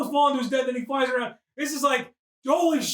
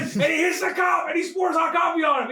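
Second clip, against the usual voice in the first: normal talking voice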